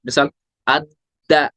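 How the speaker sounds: अद्दा slow, the dd pressed hard; pressed doubling. Shown as a demonstration. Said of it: "उस पे pressure देंगे ज्यादा". (अद्दा में दाल पर ज़ोर)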